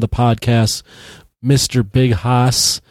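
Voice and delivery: ordinary talk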